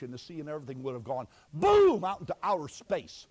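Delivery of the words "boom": "boom" loud, high and drawn out; explosive BOOM. Acted. Preacher picturing gravity gone, a loud explosive "boom". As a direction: burst out on "boom". Imitating a blast into space.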